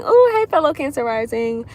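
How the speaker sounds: light up at a kindred spirit; excited greeting. Real, not acted. Streamer excitedly greets a viewer who shares her rising sign.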